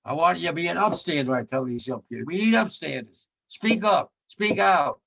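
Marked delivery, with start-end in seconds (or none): 2.3-5.0 rousing call. Acted. Exhorting young people.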